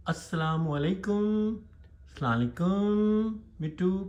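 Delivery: high-pitched pet-training call; coaxing a pet. Real, not acted. Prompting pet to repeat words.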